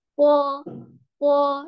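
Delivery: "Po" high, level and loud; first-tone demo. Shown as a demonstration. Teacher answering B O in tone one, high "Po". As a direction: hold "Po" on a strong high note. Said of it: "O dengan nada satu".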